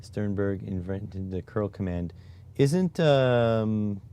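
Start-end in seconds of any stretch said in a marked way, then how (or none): none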